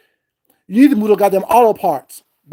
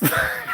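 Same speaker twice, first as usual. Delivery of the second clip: short laugh; 8 dB quieter than usual. Real, not acted. Laughs between the mock greetings.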